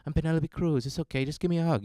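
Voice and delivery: lightly mimicked Spanish accent, soothing; a hint of a reassuring Spanish actress; Penelope Cruz. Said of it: "her voice is kinda like Penelope Cruz's".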